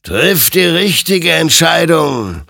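high, loud, drawn-out warning; stern admonition. A narrator's reading. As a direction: exhort him slowly and forcefully. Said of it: "ermahnte ihn sein Gegenüber".

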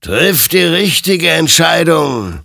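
high, loud, drawn-out warning; admonishing spirit. A narrator's reading. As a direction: stretch it out as an otherworldly admonition. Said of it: "ermahnte ihn sein Gegenüber". (laut und gedehnt ermahnend)

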